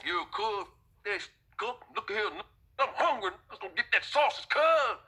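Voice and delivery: high, rising street-tough voice that climbs to a yell; egging his crew on toward the grill; gangbanger voice. Retold or imitated. Gang member urging crew for sausage.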